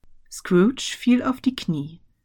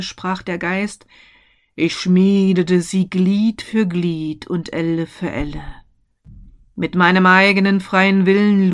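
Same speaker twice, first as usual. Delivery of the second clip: ghost voice; 6 dB louder than usual. A narrator's reading. Ghost speaks of chains.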